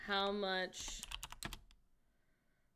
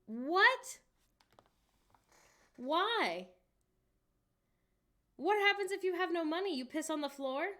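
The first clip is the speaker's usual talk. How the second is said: high, loud disbelief; real loud, high-pitched bafflement; incredulous; 9 semitones higher, 5 dB louder than usual. Real, not acted. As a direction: demand an explanation, pitch shooting up. Baffled by paid public toilets.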